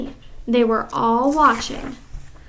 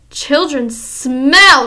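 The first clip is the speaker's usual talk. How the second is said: slow, louder drawn-out scream; 3 semitones higher, 8 dB louder, much slower than usual. Acted. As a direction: drag it out with loathing. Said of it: "she screamed".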